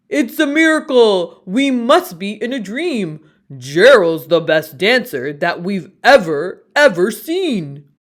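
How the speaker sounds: loud, astonished cheering; amazed shouting. Acted. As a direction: shout in wonder at the dancer. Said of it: "They shouted".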